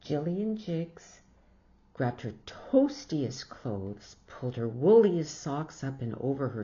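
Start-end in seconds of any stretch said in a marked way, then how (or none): none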